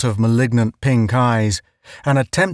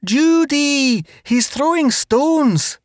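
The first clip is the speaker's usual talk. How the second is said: panicked; 13 semitones higher than usual. A narrator's reading. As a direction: warn of stones in panic. Panicked whisper to Jane.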